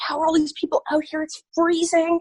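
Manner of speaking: high, fast disbelief; retold amazement. Recalling the cold crowd for the interviewer, high and amazed. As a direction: marvel at the freezing crowd.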